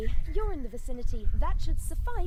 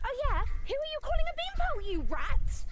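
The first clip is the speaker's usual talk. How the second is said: indignant comeback; 12 semitones higher than usual. Acted. Escalating bar insult quarrel.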